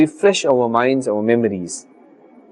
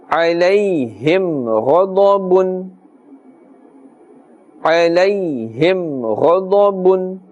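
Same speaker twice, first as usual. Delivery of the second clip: slow, sustained tajweed chant on a level tone; Quran recitation; 2 semitones higher, slower than usual. Shown as a demonstration. Teacher recites the verse for learners, slow sustained chant. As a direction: chant slowly, holding the nasal hum long.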